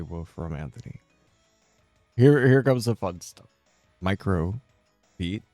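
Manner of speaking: usual speaking voice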